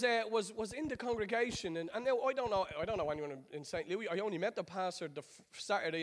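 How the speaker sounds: ordinary talk, nothing marked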